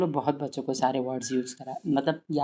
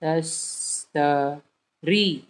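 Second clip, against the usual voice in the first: syllable-by-syllable reading; much slower than usual. Shown as a demonstration. Teacher breaks a long word into pieces, slow. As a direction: sound out तश, त, री slowly, piece by piece. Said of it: "तोड़ तोड़ के".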